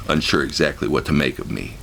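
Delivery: ordinary delivery, nothing marked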